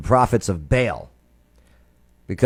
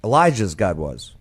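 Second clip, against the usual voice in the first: ordinary talk, nothing marked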